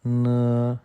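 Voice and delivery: न said as a clear dental n; न, the Chinese n sound. Shown as a demonstration. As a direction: hold the n of न. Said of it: "हम इसको बोलते हैं न".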